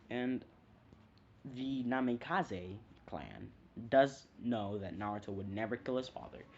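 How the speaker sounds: usual speaking voice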